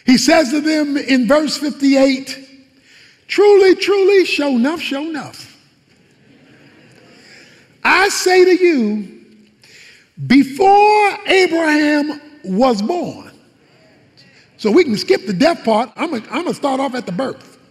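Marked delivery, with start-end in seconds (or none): 3.3-13.3 proclaiming. Acted. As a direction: ring it out slowly as Jesus' declaration. Preacher voices Jesus' declaration.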